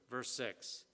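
ordinary talk, nothing marked

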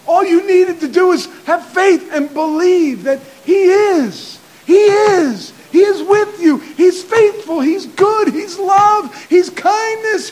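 fiery revival shout; preaching at full force. Acted. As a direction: build to a roaring climax of faith.

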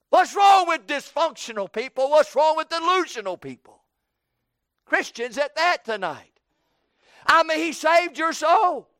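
loud, driving sermon climax; fiery preaching. Acted. Preacher thunders God's gifts at the congregation.

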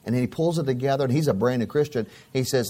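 ordinary talk, nothing marked

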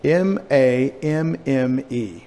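"M A M M E" spelled slowly, letter by letter. Shown as a demonstration. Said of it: "the word for grandmother in Greek".

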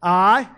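"I" hit loud and held for the crowd to answer; a loud cue word "I" for a call and response; stress on I. Shown as a demonstration. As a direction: punch "I" and pause so they can shout God. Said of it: "as soon as I say, "I," I want you to say "God".

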